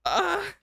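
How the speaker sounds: short, very high-pitched cry; overwhelmed squeal. Real, not acted. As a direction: squeak out real disbelief. Overwhelmed by gifted subs.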